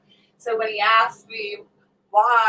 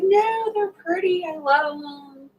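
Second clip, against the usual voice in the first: higher, slower, prissy aunt; mock aunt voice; 8 semitones higher, 4 dB louder, slower than usual. Aunt defending her long toenails, lightly mocked.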